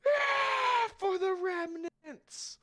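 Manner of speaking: very high, loud, drawn-out shout; war cry. Acted. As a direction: roar it as you charge. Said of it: "everyone just raises their gun and goes".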